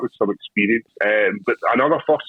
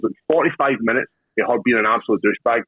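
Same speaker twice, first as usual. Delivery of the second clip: normal talking voice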